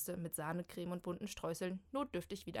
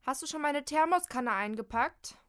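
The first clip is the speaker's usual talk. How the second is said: higher, louder call from the bathroom; 7 semitones higher, 8 dB louder than usual. Acted. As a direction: shout the question through the door. Molly ruft aus dem Bad. (laut aus dem Bad rufen)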